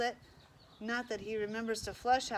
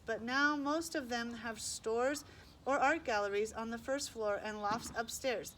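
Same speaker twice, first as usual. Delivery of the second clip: plain reading voice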